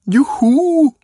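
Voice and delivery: high, loud whoop; jubilant shout. A narrator's reading. Boy whoops to his friends after fooling ladies, jubilant shout. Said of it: "råbte Jakob".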